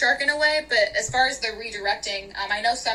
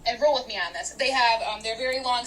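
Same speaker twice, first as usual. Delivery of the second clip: normal talking voice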